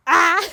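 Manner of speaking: short, high, loud "ah"; sudden yelp. Real, not acted. Rider interrupting her own chat, sudden loud cry. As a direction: cry out abruptly.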